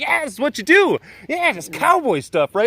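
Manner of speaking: high, goofy voice for a clueless visitor; mock the naive friend, pitched high; high put-on voice impersonating the gun-happy guest; imitating the friend. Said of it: "this person just thought".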